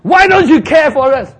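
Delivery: high, loud reproach; accusing cry. Acted. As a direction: throw the blame at him. Disciples blame Jesus.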